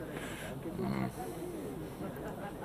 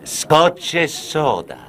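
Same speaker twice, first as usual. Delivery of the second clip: SCOTCH E SODA drawn out and higher; slow insistent order; 7 semitones higher, much slower than usual. Acted. Drinker repeating his order for Scotch e soda, slow and insistent. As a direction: spell out the drink order slowly, higher pitched.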